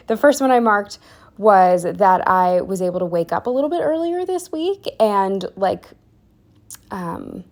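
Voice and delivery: ordinary delivery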